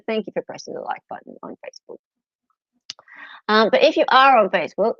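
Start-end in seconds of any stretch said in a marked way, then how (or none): 0.1-2.0 fast, unvoiced thanks; quick whisper. Real, not acted. Thanking the one Facebook liker in a quick whisper. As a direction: rush it out under the breath.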